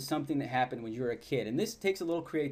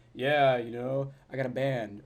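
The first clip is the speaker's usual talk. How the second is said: slow, drawn-out dull-guy talk; mock boring guy; 3 dB louder, slower than usual. Mocking how guys usually chat up a woman, slowly and dully. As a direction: imitate a guy with nothing interesting to say. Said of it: "you know how guys normally talk".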